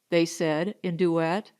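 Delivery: ordinary delivery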